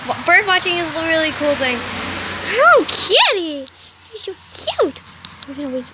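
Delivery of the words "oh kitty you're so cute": high gushing coo at the kitty. Real, not acted. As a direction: coo over the cat. Cooing at the cat.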